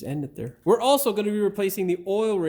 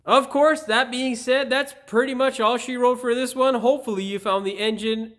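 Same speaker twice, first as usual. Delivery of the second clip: bright, loud presenter voice, higher; upbeat host read; 7 semitones higher, 5 dB louder than usual. Acted. Channel host pitching subscribe to viewers, upbeat presenter read.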